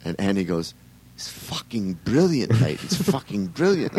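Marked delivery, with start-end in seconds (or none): 1.2-4.0 higher-pitched, drawn-out mock Scottish praise; Scottish accent attempt. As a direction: imitate a Scottish singer's emphatic approval. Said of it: "I can't do a Scottish accent".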